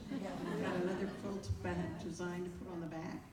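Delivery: ordinary talk, nothing marked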